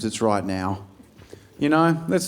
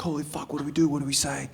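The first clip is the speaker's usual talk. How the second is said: hushed panic; 9 dB quieter, faster than usual. Retold or imitated. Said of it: "Everyone's like".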